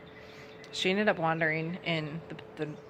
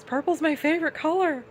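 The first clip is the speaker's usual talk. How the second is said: much higher and louder, voice cracking with tears; imitating a tearful cry; 11 semitones higher, 6 dB louder than usual. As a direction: blurt it out through sudden tears. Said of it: "she just started crying".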